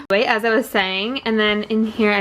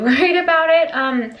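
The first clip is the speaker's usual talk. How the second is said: laughing; 7 semitones higher than usual. Real, not acted. Laughs mid-sentence about worrying.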